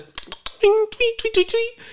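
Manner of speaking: high bird chirping tweets. Pretend. Imitating birds of paradise.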